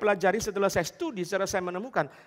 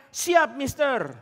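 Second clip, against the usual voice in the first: slow, drawn-out, higher eager reply; eager agreement; 7 semitones higher, 7 dB louder, much slower than usual. Acted. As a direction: stretch out an eager yes sir. (pemuda menjawab bule dengan semangat, dipanjangkan)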